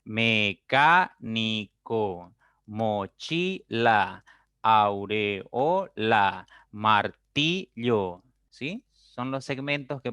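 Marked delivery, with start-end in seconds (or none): 0.0-8.2 slow and louder, each word in separate beats; me-cá-ni-co, mo-chi-la, au-re-o-la, mar-ti-llo; split into syllables. Shown as a demonstration. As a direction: martillo and mochila said slowly, syllable by syllable. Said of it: "golpes de voz".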